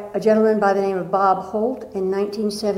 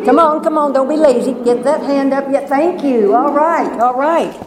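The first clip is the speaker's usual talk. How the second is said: louder and higher, urging everyone on; rousing the crowd; 4 semitones higher, 6 dB louder than usual. Real, not acted. Preacher urges the congregation to raise hands, rousing and loud. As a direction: pump up the room to get hands raised.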